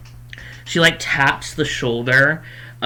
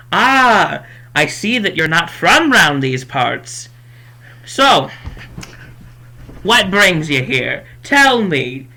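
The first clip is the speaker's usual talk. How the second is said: high, loud folksy host; hearty put-on welcome, pitched up; welcoming shopkeeper; 4 semitones higher, 5 dB louder, slower than usual. Shopkeeper inviting newcomers to sit, loud and high.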